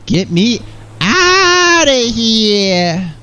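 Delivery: high, long-stretched wail for rescue; acted desperate yell. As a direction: holler it, dragging out the vowels. Acts out a cry for escape.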